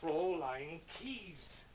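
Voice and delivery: "Fraulein Keyes" drawn out very slowly; slow, deliberate "Fraulein Keyes" to make it understood; emphatic repetition. Acted. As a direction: spell out "Fraulein Keyes" by stretching each word. Repeats the name after her outburst.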